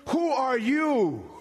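high, slow defiant question; bold challenge. Acted. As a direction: call out the mountain. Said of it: "preacher challenging the mountain".

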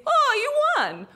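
high, slow, delighted announcement; mock cheer. Scam man fakes delight telling the tourist she won, high slow cheer. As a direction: sell the fake win with big glee. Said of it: "points and says".

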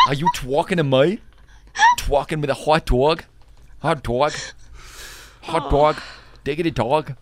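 questioning, exaggerated New York vowels; overdone New York W sounds; New York accent. Host practising for quiz, hammed-up New York accent. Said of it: "trying to do New York accents".

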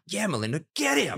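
high-pitched mock viewer cheer; cheering her on. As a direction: egg her on, pitch leaping up. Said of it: "I watched the show and I'm like".

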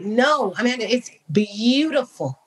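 ordinary delivery

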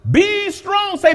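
high ringing command; exhortation. Acted. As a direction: lift them up with it. Exhorting the congregation.

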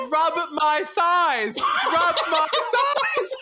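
very slow, high, laughing mock protest; exasperated correction. Boy correcting partner who misheard him, drawn-out exasperated retelling. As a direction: spell it out word by word through laughter.

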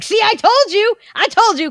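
put-on high, gleeful kid's told-you taunt; gloating kid. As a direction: crow it like a smug little brother. Gloating sibling on phone.

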